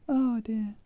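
normal talking voice

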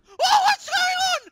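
sudden loud panicked shout; startled shout. Real, not acted. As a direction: yell out in alarm.

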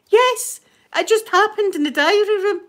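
high, loud retold insistence; mock hysterical. Housemate confirming the death to the others, mock hysterical.

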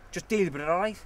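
loud, hard challenge thrown at a rival; angry threat. Acted. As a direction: square up and dare him to answer. Lads squaring up to fight.

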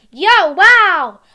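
loud gleeful yell; excited shout. Acted. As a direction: whoop it out with glee. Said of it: "he said".

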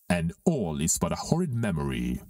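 normal talking voice

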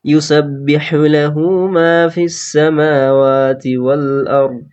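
slow, drawn-out chanted recitation; Quran recitation. Acted. Teacher reciting the verses to the class, slow melodic chant.

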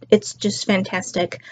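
ordinary talk, nothing marked

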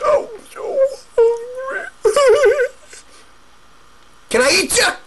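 high, greedy troll voice; begging, whining troll who wants the goat; hungry troll. Acted. Troll begging to eat the goat, high and greedy.

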